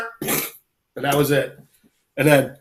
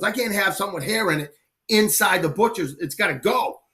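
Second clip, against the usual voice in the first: higher-pitched mock indignation; indignant butcher; 6 semitones higher than usual. Butcher refusing the hairy pig in his shop, indignant. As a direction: imitate an exasperated shop owner refusing.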